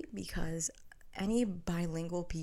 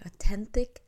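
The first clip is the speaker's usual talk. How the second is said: "authentic" with a hard T for the th; Filipino accent; 4 semitones higher, 10 dB louder than usual. Real, not acted. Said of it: "There goes my accent".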